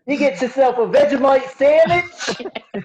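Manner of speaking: slow mock Australian accent; co-host joins the bit with an Australian accent. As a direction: play the Aussie butler offering food. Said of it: "That's his best accent".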